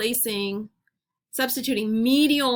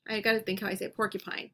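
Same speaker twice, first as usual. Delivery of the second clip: ordinary delivery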